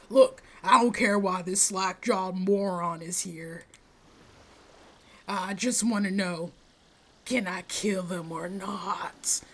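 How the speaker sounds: slow threat sinking into a whisper; menacing character. Acted. Menacing game character threatens the player.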